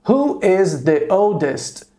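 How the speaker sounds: slow, careful model question. Shown as a demonstration. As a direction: say it slowly for students to repeat. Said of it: "Eu poderia perguntar". (pergunta modelo bem devagar)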